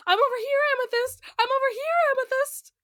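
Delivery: high, sing-out character voice; high-pitched mimicked calling; hologram Pearl call.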